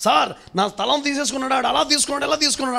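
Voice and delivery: high, hurried mock plea; panicked complaint, imitated. Retelling a worried person's complaint. (కంగారుగా ఫిర్యాదు చేస్తున్నట్టు అనుకరణ)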